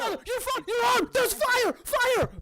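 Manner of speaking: very high frantic yelling; hammed-up shrieking alarm; panicked screaming. Brother sees the arm on fire, panicked screaming.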